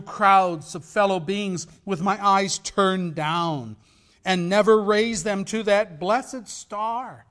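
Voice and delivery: very loud and slow, declaimed; ghost's lament. Acted. Ghost of Marley crying out to Scrooge, loud and slow. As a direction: wail it like a chained ghost. Ghost speech read dramatically.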